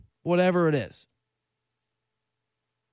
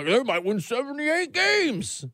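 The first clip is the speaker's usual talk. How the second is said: mock hype voice; 4 semitones higher, slower than usual. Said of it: "there were some people".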